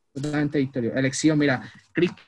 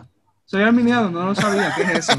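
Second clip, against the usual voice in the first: higher, slightly laughing clueless voice; clueless arminian caricature; 4 semitones higher than usual. Retold or imitated. Mocking arminians who don't know their label, clueless. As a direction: sound like you just found out, bewildered. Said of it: "los arminianos es como que".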